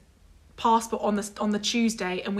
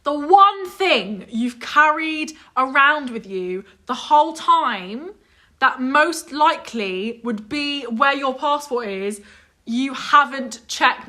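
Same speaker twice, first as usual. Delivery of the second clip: slow, raised, word-by-word disbelief; exasperated emphasis; 3 semitones higher, 4 dB louder, slower than usual. Real, not acted. Friend ranting about the unchecked suitcase, exasperated and emphatic. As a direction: spell it out loudly and slowly, incredulous. Incredulous rant at friend's stupidity.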